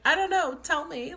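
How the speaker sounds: high, loud, panicked plea to the doctor; retelling her frantic plea to the doctor; desperate plea. Retold or imitated. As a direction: cry out for answers in pain. Begging doctor while in pain.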